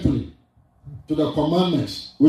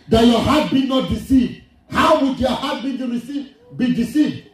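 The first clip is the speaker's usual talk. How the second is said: high, urgent preacher's delivery; emphatic preaching; 6 semitones higher than usual. Real, not acted. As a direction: press the warning on the congregation. Preaches to congregation.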